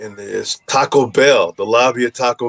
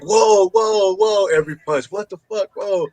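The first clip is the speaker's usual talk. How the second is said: retold shock, pitched up; mock alarmed bystanders; 7 semitones higher than usual. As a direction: imitate a crowd reacting to each punch. Onlookers react to a beating.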